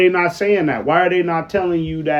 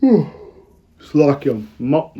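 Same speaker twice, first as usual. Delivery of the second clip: slow and yawning; much slower than usual. Real, not acted. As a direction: talk through a real yawn. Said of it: "yawning a little bit".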